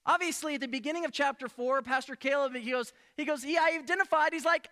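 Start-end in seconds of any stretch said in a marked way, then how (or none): none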